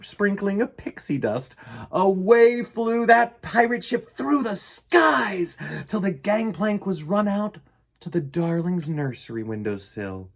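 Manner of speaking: usual speaking voice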